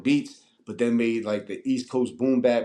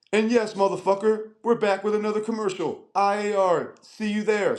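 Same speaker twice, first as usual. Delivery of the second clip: hammed-up radio announcer, much higher; 10 semitones higher than usual. The Hot 97 ad answering his dare, hyped much higher announcer voice. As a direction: belt it like a hyped school commercial. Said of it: "that joint was like".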